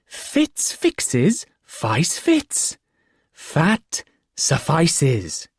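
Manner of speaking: high, very slow, each word shaped; elaborate enunciation. A narrator's reading. The fool enunciating the rhyme to the boy, slow and high. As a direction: spell it out word by word. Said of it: "he enunciated elaborately".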